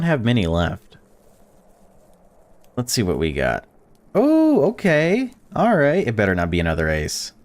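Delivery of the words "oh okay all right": excited surprise. Real, not acted. Big card reveal in game.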